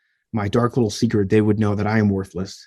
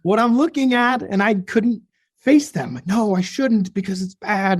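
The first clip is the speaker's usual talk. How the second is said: conflicted inner voice; 8 semitones higher than usual. Retold or imitated. Torn inner voice of teen self.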